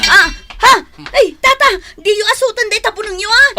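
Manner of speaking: high, loud alarmed yell; shrill-pitched loud outcry; alarmed shout. Acted. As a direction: shout in sudden alarm at the old man.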